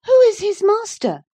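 put-on very high, loud, pestering girl; tiresome girl. Girl appealing to the housekeeper, high and pestering. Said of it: "continued the tiresome girl".

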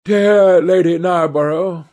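ordinary delivery, nothing marked

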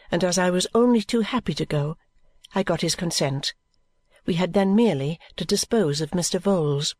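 ordinary delivery, nothing marked

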